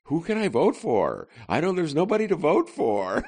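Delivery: high, whining mock voter; whiny Chicago voter. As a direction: moan it like a resigned voter. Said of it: "typical Chicago voter will go".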